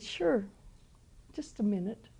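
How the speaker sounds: quiet, easy answer from the Lord; the Lord's joking reply. Retold or imitated. The Lord teasing the lady about a million dollars, soft and easy. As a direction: drop the voice for God's punchline.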